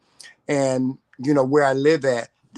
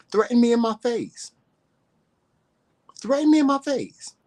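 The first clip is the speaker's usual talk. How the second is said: much higher each time; rising dare; 10 semitones higher than usual. Real, not acted. Daring online haters to face her, high-pitched dare.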